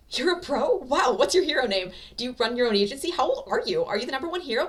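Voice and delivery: high, loud, eager rush; excited kid. A narrator's reading. Young Deku meeting a pro hero, starstruck excitement. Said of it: "his eyes light up".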